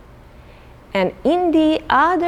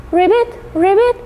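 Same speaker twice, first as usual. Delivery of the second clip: high, loud ribbit; frog ribbit; 11 semitones higher, 3 dB louder than usual. Acted. Teacher making frog sounds for the children. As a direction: croak like a little frog.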